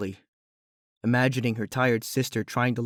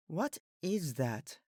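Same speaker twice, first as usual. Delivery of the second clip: much softer, slow, muffled through a door; 5 semitones higher, 12 dB quieter, much slower than usual. A narrator's reading. Celestia asks from outside, muffled through the door. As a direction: sound like you're behind a wooden door. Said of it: "came her muffled voice".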